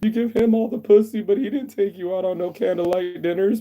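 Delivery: high, loud falsetto of a weepy woman, hammed up; mock crying woman. Mocking the crying date he never became, in a shrill high put-on voice. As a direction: mimic a sobbing stranger begging for comfort, way up high. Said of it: "start crying on the beach and go".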